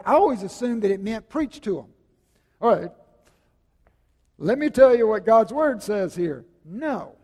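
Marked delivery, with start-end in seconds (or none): 2.6-6.4 loud, booming mock sermon voice; hammed-up pulpit bellow, louder; mock preacher.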